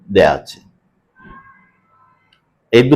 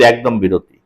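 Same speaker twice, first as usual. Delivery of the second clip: ordinary delivery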